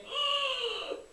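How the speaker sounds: high, sudden gasp of shock; sharp gasp. Real, not acted. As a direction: suck in air in amazement. Reacting to the match reveal.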